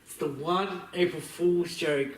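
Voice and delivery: normal talking voice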